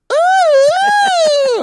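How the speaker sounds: shrill falsetto moan; high loud moan. Retold or imitated. Imitating neighbor's moan.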